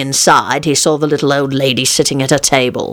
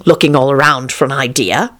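usual speaking voice

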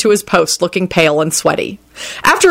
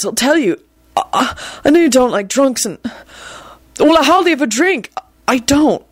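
higher-pitched, stumbling desperate protest; desperate babbling; 7 semitones higher than usual. A narrator's reading. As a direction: plead your case, tripping over words. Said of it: "he said desperately".

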